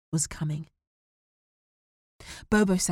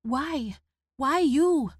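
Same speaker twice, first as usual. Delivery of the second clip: high, loud despairing cry; upset son; anguished boy; 9 semitones higher, 4 dB louder, much slower than usual. Acted. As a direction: protest through tears.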